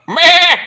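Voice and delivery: loud, high mock bleat; a fan mimicking a goat, loud and high. Mocking mom with a goat.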